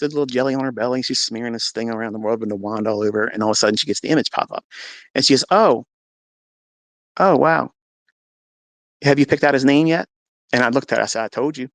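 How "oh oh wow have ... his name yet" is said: higher, louder impressed nurse; imitated nurse's wowed reaction; delighted surprise. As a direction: exclaim at what the scan shows. Nurse voiced seeing the image.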